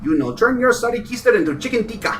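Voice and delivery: put-on Indian accent. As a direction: scold him in an Indian accent. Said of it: "my Indian voice".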